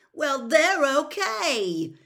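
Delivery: loud, high, drawn-out protest; slow indignant shout; angry. A narrator's reading. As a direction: complain angrily at full voice. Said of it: "said Ron angrily".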